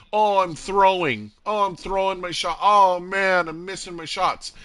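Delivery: high mock whine of self-pity; mock complaining. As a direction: imitate players moaning about their aim. Mimics players excusing bad games.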